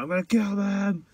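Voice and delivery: high, put-on mock killer voice; a hammed-up murderous uncle; uncle's threat. Friend mimicking the uncle on the drive, high and hammed-up. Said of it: "when he's like".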